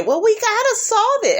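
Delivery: high, prissy suburban mom voice. Retold or imitated. Kenya mocked as a suburban mom, high and prim.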